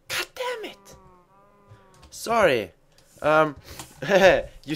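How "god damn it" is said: shrill frustrated squeak; high squeal. Real, not acted. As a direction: squeal it out in falsetto.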